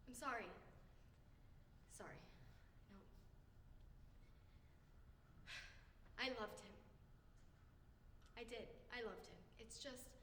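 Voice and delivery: low, very soft, halting apology; subdued remorse. Acted. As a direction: retreat into a small, quiet voice. Acted remorse after harsh words.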